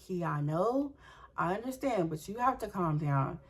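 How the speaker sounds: soft, understanding reply; calming mom. Retold or imitated. Mom answering her screaming daughter, gently calming.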